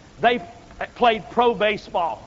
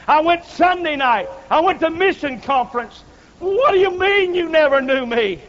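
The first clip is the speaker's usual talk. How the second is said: loud, higher outraged pleading; indignant protest; 5 semitones higher, 4 dB louder than usual. Acted. Churchgoer protesting loudly to God at judgment. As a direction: protest hard that you did everything right.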